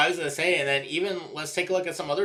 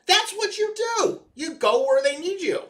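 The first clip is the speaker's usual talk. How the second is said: emphatic preaching; 5 dB louder than usual. Real, not acted. Coach-like lecture on positions.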